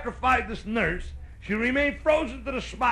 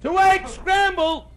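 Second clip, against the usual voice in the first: high, loud, drawn-out order call; slow, high shout across a diner; waitress yell; 11 semitones higher, 6 dB louder, slower than usual. Acted. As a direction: holler the order to the kitchen. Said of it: "the waitress yells".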